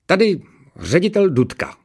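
high-pitched official on the line; officious headmaster. A narrator's reading. Headmaster phoning a pupil's mother, officious. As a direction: announce yourself in a high, formal voice.

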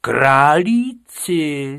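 loud, drawn-out incredulous question; outraged, slow teacher exclamation. A narrator's reading. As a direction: stretch the word out high and loud. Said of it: "zvýšil hlas pan učitel".

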